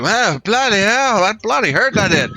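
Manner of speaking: high, wailing complaint; pained character grumble. Acted. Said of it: "she's like".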